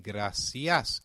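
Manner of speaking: gracias with a clear s, grah-see-as; Latin American Spanish. Shown as a demonstration. Teacher models the Latin American way, gracias with s. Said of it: "in Latin American Spanish".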